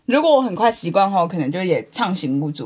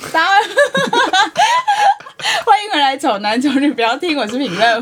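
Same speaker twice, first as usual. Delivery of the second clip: mock broadcast host; 8 semitones higher, 3 dB louder than usual. Mock broadcast over chief's speaker.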